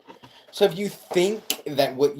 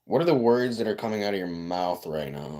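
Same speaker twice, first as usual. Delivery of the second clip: ordinary delivery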